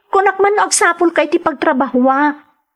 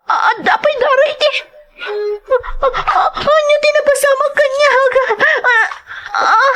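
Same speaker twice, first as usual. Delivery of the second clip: high, shaky panic with cries; panicked distress; 8 semitones higher than usual. Acted. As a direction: stammer in fright over the blood. Finds someone bleeding, panics. (nagbuteng ken agsangsangit)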